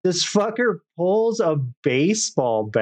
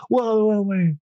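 higher wheedling mock voice; imitating the stranger; 6 semitones higher than usual. S0 mimics the guy asking for cigarette money, higher mock voice. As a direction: beg for change in a pitched-up put-on voice.

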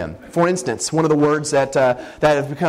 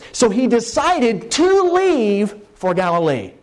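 slow, higher-pitched "to leave"; stress on to leave; 6 semitones higher, slower than usual. Shown as a demonstration. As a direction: draw out "to leave" slowly. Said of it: "the infinitive here is to".

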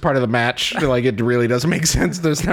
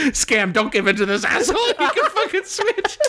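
laughing mock rant; 11 semitones higher than usual. Retold friend urging, laughing.

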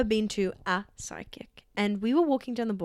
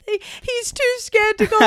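mock scaredy husband; 11 semitones higher, 7 dB louder than usual. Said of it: "their husbands were like".

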